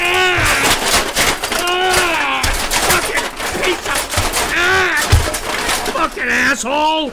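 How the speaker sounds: screaming curses at the splice, loud and high; real rage at the audio; yelling in fury at whoever did it; furious shout. Real, not acted. Raging at bad splice.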